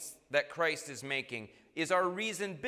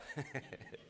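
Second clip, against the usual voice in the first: brief, soft chuckle; quiet laugh at your own joke; short laugh; 10 dB quieter than usual. Real, not acted.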